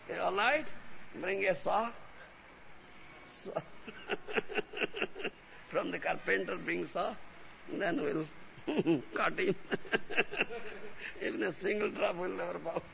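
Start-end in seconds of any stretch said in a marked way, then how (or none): 3.6-5.3 long, breathy chuckling; amused, wheezy laugh; real laughter. Real, not acted. Laughs at his saw joke. 7.7-11.2 chuckling around the words; amused, laughter breaking up the phrase; laughing. Real, not acted. Laughs through his saw joke.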